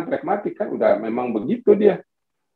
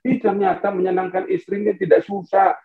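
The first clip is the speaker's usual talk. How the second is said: usual speaking voice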